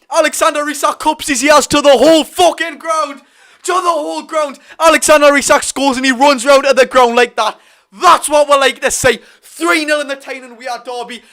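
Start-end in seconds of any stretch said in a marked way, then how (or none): 0.1-6.7 high, loud breathless shouting; excited shouting. Real, not acted. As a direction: yell it out still buzzing from the goal. Fan still shouting after goal.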